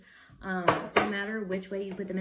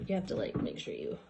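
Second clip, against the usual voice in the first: normal talking voice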